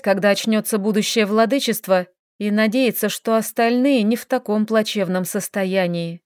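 usual speaking voice

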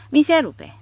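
high, loud, cocky sneer; grinning retort. A narrator's reading. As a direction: toss it off with a grin. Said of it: "ripostă rânjind micuțul". (replică obraznică, rânjind)

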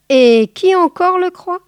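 high loud outraged question; loud, high indignant boa. Acted. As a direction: fling the question back in disbelief.

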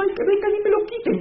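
quejido agudo y exagerado de llorón; lloriqueo burlón. Pretend. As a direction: imita al creyente que llora en la esquina, muy agudo. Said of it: "llorando en una esquina diciendo". (burla de un llorón en la esquina, agudo y quejumbroso)

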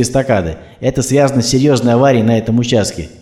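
brisk, even radio news delivery; radio news read. Acted. Radio host reports traffic jams, brisk news delivery.

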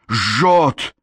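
high, short cry of pain; pained howl. A narrator's reading. Boy burned by chains, pained cry. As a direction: yelp at the burn, pitch jumping up. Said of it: "Пит взвыл от боли".

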